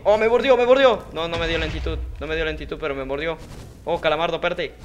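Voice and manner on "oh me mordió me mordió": higher and louder; pained yelp. Real, not acted. Gamer bitten by the wolf, yelp.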